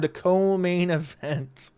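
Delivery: slow, drawn-out fight announcement; restrained mock-announcer build-up; announcer intro. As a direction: stretch out the title like a ring announcer. Mock-hyping the next fight.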